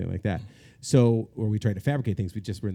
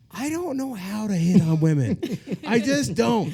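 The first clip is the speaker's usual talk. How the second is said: higher, slow, laughing protest; laughing panic; 10 semitones higher, 5 dB louder, slower than usual. Retold or imitated. Man tells his friend he can't hit on women, laughing and pleading slowly. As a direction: plead through laughter that you can't flirt.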